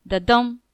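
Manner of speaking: thump out "dum" after a light "the"; heartbeat stress. Shown as a demonstration. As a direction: beat like a heart, weight on "dum". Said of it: "one faint beat followed by a louder beat".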